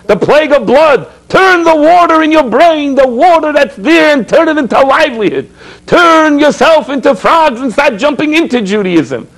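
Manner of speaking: lifted, driving exhortation; rousing sermon. Acted. Rabbi rallying his listeners, fervent preaching. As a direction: stir the crowd to jump into Judaism.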